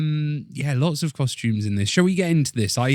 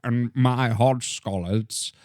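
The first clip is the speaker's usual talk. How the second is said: slow, drawn-out posh actor voice; Colin Firth voice; slower than usual. Retold or imitated. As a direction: ham it up like a grand old actor. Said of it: "he was doing a voice".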